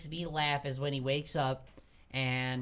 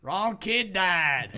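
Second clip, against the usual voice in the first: loud, high, very drawn-out catchphrase; movie father; 4 semitones higher, 6 dB louder, much slower than usual. Retold or imitated. Imitating the father blaming his son, loud and drawn out. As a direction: stretch it out as a hammed-up accusation. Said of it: "He's like".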